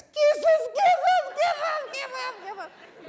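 rapturous falsetto squeal, fading at the end; hammed-up high gushing; delighted dog lover. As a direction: squeal it loud, then run out of breath. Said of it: "this human is interpreting as".

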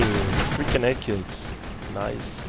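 normal talking voice

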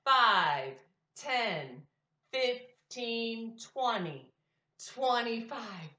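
loud counting by fives with a class. Shown as a demonstration. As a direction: call out each five clearly. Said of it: "Count with me".

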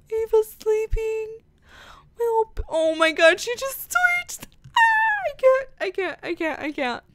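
overwhelmed cooing. Real, not acted. Gamer melting as baby Ava twitches asleep, overwhelmed cooing. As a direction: melt into a squeaky coo at something cute.

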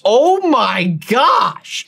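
very drawn-out, slow disbelief; astonished exclamation. Real, not acted. Gamer learning of huge playtime, slowly astonished. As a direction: stretch each word in amazement.

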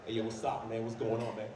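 put-on low, cool American-accent greeting. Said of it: "I am now proud of my African accent".

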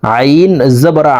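final আ drawn out as Arabic ain; long mid-throat ain. Shown as a demonstration. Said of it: "হলকের মধ্যখান থেকে আদায়".

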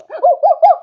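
very loud, high panicked oohs; alarmed hooting. Real, not acted. Player sees danger approaching, alarmed hooting. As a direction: hoot quickly in alarm.

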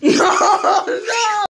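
high, loud laughing protest; laughing shout. Real, not acted. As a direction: shriek it out through laughter. Being found, laughing.

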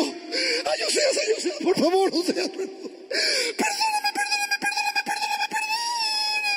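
very high, wailing plea for forgiveness; crying. Acted. Wife returned to her husband, begging forgiveness in tears. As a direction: sob and beg, rising into a long wail. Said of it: "Ella está llorando".

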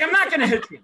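high, loud protest; exasperated yell. Retold or imitated. Angry boss answering the wary teen, exasperated yell.

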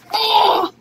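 loud showy grunt; shout. Pretend. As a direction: bellow while flexing. Flexing in tight shirt.

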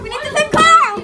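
man down shout. Pretend. Pretend surgery, patient bleeding out.

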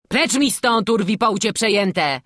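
high, loud angry guard; angry shout. A narrator's reading. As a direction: yell them away from the gate. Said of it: "krzyknął ze złością". (gniewny krzyk)

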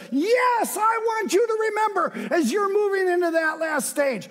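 high, loud preaching, God's promise ringing out; fervent sermon voice, high and loud; preaching at full force. Acted. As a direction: proclaim it like thunder from the pulpit.

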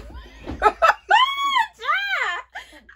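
high, loud screaming laugh; a shrieking burst of laughter; shocked at her own reflection, loud and high. Real, not acted. Shocked by the wig on her head.

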